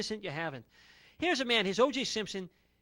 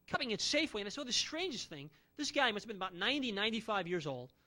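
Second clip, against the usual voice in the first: ordinary delivery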